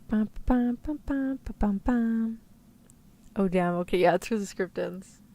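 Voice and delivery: usual speaking voice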